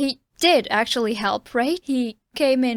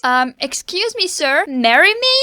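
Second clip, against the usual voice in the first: louder, rising plea; mock proposal; 4 semitones higher, 8 dB louder than usual. Fan proposing to Ellen across the room, loud and eager. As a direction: call out a playful proposal.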